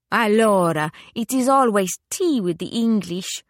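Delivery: Italian widow crying out in mild protest; exclaiming. A narrator's reading. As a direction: exclaim like an exasperated Italian lady. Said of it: "she exclaimed".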